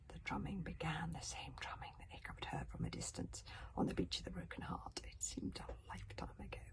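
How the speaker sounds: soft eerie whisper; hushed narration. A narrator's reading. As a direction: whisper the dread in. Ominous drumming, eerie narration.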